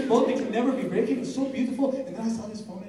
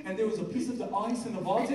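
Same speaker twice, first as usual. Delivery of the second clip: ordinary talk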